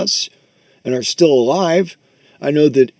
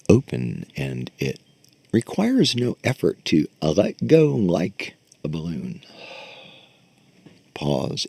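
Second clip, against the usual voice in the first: soft, unhurried meditation voice; guided meditation; 4 semitones lower, 4 dB quieter, slower than usual. Acted. Teacher guides students through breathing, soft meditation voice. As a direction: talk them into letting go.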